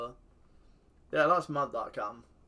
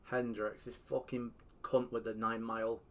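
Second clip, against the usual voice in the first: ordinary delivery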